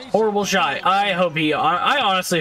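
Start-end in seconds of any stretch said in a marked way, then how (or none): none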